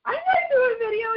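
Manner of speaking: high, wailing whiny refusal; panicked refusal. Retold or imitated. Julie balks at Nadia's push, high wail. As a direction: squeal it like a scared little kid. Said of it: "I'm like".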